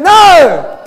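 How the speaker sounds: high, very loud NO; forceful preacher's bellow; shout with conviction. Shown as a demonstration. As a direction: hurl the word out with total conviction. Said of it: "Say it with conviction".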